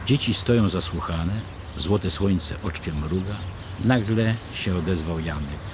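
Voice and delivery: ordinary delivery, nothing marked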